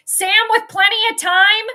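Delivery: loud, high call of the play. Real, not acted. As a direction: call it out as it happens.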